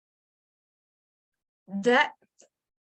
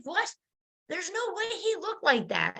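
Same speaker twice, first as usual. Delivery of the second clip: disbelief; 8 semitones higher, 4 dB quieter than usual. Retold or imitated. Disbelief at Jesus's looks.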